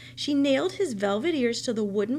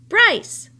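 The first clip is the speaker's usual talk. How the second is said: high, loud angry shout; 10 semitones higher, 13 dB louder than usual. Acted. Old woman shouting at the boy. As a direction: yell his name in anger. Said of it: "shouted the old woman".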